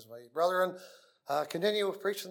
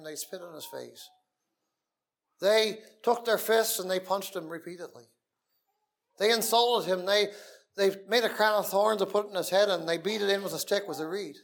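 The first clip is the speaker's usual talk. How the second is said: raised, louder preaching voice; fiery preaching; 4 semitones higher, 7 dB louder than usual. Acted. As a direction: preach it out with full force. Preaching the crucifixion.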